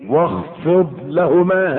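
slow, drawn-out recitation of واخفض لهما. Shown as a demonstration. Preacher slowly reciting the verse to the congregation, drawn out. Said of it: "ها؟".

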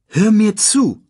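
high, loud cut-in; firm interruption. Acted. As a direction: cut him off sharply. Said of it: "unterbrach ihn Nala".

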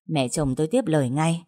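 plain reading voice

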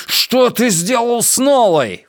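high, drawn-out furious yell; howled accusation at high pitch. A narrator's reading. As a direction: yell it high, stretching the words. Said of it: "вопил Трэвис".

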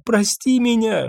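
high, breaking plea through sobs; sobbing apology. Acted. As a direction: cry out for forgiveness. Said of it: "уткнулась в него, рыдая".

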